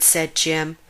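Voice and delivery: plain reading voice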